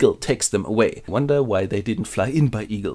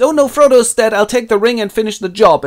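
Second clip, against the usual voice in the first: much higher and louder, squeaky dismay; hammed-up shocked little hobbit; mock panicked Sam; 10 semitones higher, 6 dB louder than usual. Sam's lines voiced.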